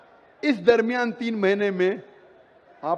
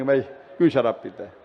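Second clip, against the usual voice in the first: lightly acted, low-pitched questioning; brother confronting husband; 8 semitones lower than usual. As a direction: drop your pitch to take the husband to task. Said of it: "आप जाके उससे बात करेंगे". (भाई की धीमे सुर में हल्की पूछताछ)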